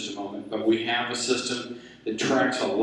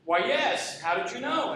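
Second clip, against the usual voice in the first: higher-pitched acted amazement; mock astonishment; 9 semitones higher than usual. Man in the balloon to the one who guessed his job, astonished. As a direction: play astonished, pitch climbing on the question. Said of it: "the guy in the balloon is astonished".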